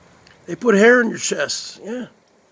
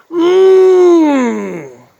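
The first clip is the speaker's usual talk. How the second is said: hammed-up climax moan, much higher and louder; very high, very loud mock moan; 10 semitones higher, 9 dB louder than usual. Streamer's final bite for viewers, huge mock moan. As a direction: top every moan before it. Exaggerated eating moans.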